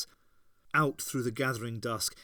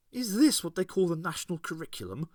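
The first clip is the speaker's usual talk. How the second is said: quick, lightly scornful question; a touch of a scoffing mother; mild scorn; 5 semitones higher, faster than usual. A narrator's reading. Ace's mother mocking the lesson, lightly scornful. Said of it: "said Ace's mother scornfully".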